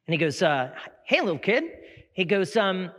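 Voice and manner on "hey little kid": put-on high, chirpy salesman greeting a kid; higher-pitched phone salesman. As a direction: pitch it up to greet a small child. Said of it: "there's a salesman on there".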